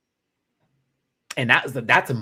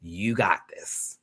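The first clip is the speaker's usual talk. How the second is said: slow, tender comforting; slow, soft mama reassurance; gentle grandmother; 5 semitones lower, 3 dB quieter, much slower than usual. Retold or imitated. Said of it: "grandmother with her grandbaby going".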